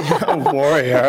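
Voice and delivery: laughing, slow disbelieving echo; laughing disbelief. Real, not acted. Laughing echo of her boast.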